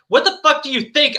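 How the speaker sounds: high-pitched narrated indignation; angry retort. A narrator's reading. Ebony answering Bloody Mary's question, angry. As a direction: snap back with mild indignation. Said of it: "I asked angrily".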